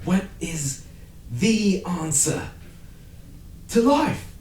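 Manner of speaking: high, slow, grand British voice; British accent. Acted. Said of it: "That's my British accent".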